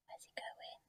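very soft whisper of suspense; breath-only wondering question; hushed whisper. Acted. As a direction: whisper it, barely audible.

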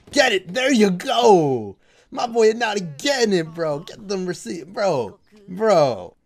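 loud, high hype shouts; excited cheering. Real, not acted. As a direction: cheer your player on at full voice. Cheering on the player.

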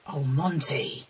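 normal talking voice

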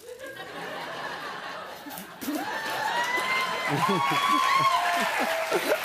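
high burst of laughter. Real, not acted. Botched boomerang, bursts out laughing.

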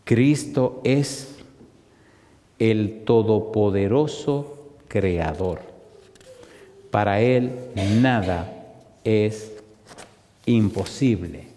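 very slow, with long pauses between words; solemn slow declaration. Real, not acted. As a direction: weigh each word, letting it sink in.

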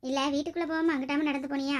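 ordinary talk, nothing marked

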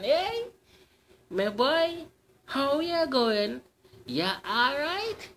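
high, slow dainty lady's voice; dainty feminine voice. Acted. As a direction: sweeten it into a coaxing ladylike lilt. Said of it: "In a dainty feminine voice".

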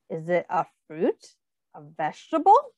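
normal talking voice

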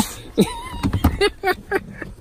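squealing helpless laughter; high shrieking laugh. Real, not acted. Owner under the playful dog, shrieking laugh.

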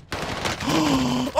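long high gasp; big audible gasp before yelling; excited gasp. Real, not acted. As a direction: suck in air in amazement. Amazed by the gun.

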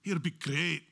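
playful mock Scottish-Irish accent; brief, hammed-up Scottish accent on the line. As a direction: put on a Scottish or Irish brogue. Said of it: "an Irish accent or, or Scottish accent".

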